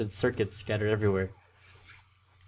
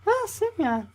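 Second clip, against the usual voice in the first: put-on very high girl voice; Slavia voice; slower than usual. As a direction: squeal it like a bright camp girl. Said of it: "saw Slavia".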